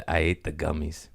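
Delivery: ordinary talk, nothing marked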